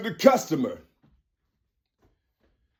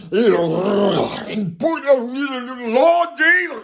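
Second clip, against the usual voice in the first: mock preacher growling and spluttering; slobbering preacher; 6 semitones higher, 3 dB louder, slower than usual. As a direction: growl and splutter like a worked-up preacher, climbing higher. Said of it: "the preacher is slobbing and spitting and salivating".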